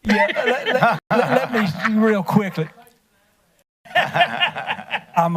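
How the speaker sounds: words broken up by laughter; laughing. Real, not acted. A speaker laughing at the council meeting while trying to go on.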